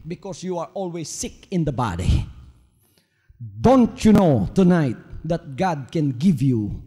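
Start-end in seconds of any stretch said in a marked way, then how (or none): 3.4-6.8 fervent sermon appeal; preaching at full force. Acted. Preacher calls to congregation.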